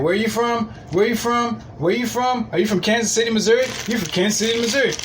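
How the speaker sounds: high-pitched, repetitive mock interrogation; mock going off. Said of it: "I went off on this chick".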